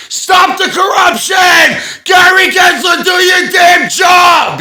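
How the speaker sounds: very loud, high, slow bellow; protest shout. Pretend. Protester shouting at the SEC, full bellow. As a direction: yell it across the plaza. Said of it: "my voice is so loud".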